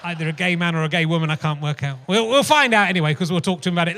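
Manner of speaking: usual speaking voice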